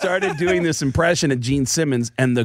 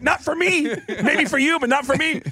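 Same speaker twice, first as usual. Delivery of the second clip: high, laughing protest. Real, not acted. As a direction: squeak it out through laughter. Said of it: "And I was like".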